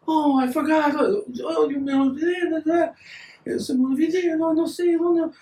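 very high, singsong imitation of native accent; native Mexican accent. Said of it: "native accent".

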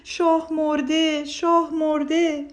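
loud, slow, high lament; old man mock-mourning loudly; wailing. A narrator's reading. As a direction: wail it like grieving a king.

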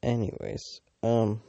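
ordinary talk, nothing marked

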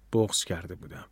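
usual speaking voice

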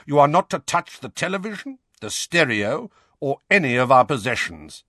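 stern uncle. Acted. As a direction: lay down the rules like a bossy uncle. Uncle Vernon laying down rules.

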